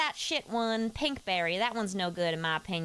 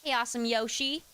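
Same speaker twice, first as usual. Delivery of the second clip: ordinary talk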